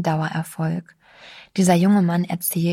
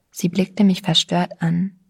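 ordinary delivery, nothing marked

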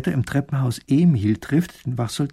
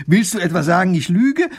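indignant; 6 semitones higher, 6 dB louder than usual. A narrator's reading. Wife offended by husband's doubt.

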